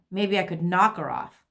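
ordinary delivery

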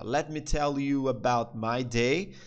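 very slow with rising end; slow demo. Shown as a demonstration. Teacher replaying the line for students, slow. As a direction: stretch it out for learners. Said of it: "vamos ouvir de novo o que ele fala".

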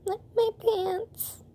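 put-on high, slow little-boy whimper; small child pointing at his wet pants; whimpering kid. Little Shane fretting about his pants to Toshio, high slow whimper.

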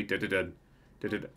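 low, soft spooky du-du-dun; creepy sting. Pretend. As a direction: hum a sneaky suspense sting. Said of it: "let's creepily walk over".

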